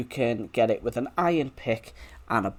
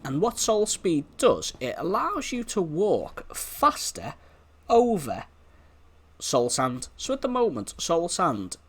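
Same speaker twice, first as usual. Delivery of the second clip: ordinary talk, nothing marked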